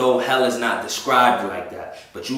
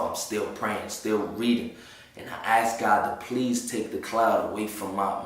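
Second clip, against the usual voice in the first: ordinary delivery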